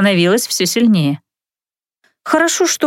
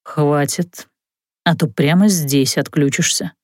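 grumbling; 6 semitones lower, slower than usual. Acted. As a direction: mutter it in annoyance. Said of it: "проворчав".

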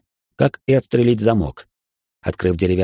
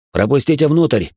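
firm, raised commanding voice; commanding order; 5 semitones higher, 3 dB louder than usual. A narrator's reading. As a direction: order them to let you in. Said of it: "властно приказал майор". (властный приказ)

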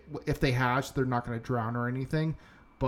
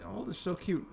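higher, soft imitation of an admirer; mock cooing; 7 semitones higher, 6 dB quieter than usual. Imitating people who find geese cute, mock cooing. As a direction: coo it lightly, imitating naive fans.

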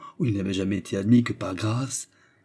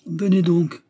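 higher, louder summons; exclaiming; 8 semitones higher, 5 dB louder, slower than usual. A narrator's reading. Manager deciding to take the suitor home, exclaiming. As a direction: call out the invitation sharply. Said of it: "s'écria le régisseur".

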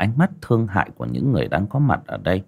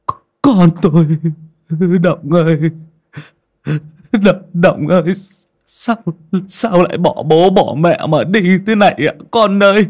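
loud, high keening lament; wailing in grief; 11 semitones higher, 7 dB louder than usual. Acted. Father wailing over his drowned daughter, loud high lament. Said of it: "mà gào lên".

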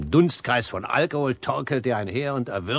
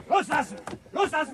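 shouting to stop a fight. Acted. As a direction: bark it to pull them apart. Breaking up a street fight.

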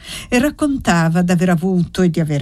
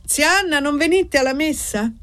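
called out; 8 semitones higher than usual. A narrator's reading. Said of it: "Annessa gridò".

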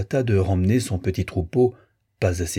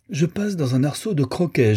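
put-on boasting boy; 7 semitones higher than usual.

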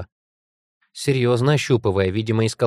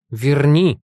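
louder, insistent order; angry repeated demand; 3 semitones higher, 5 dB louder than usual. A narrator's reading. Heroine looming over her monster, angry demand. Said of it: "Я так сильно разозлилась и испугалась".

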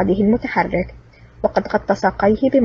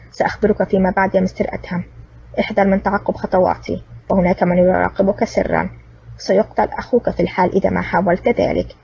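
ordinary delivery, nothing marked

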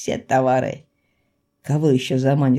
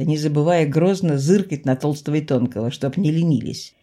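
usual speaking voice

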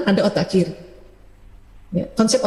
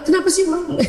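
mock child whine; 9 semitones higher than usual. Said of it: "besok Bunda marah-marah".

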